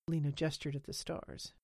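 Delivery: usual speaking voice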